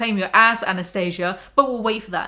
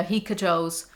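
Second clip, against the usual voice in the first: normal talking voice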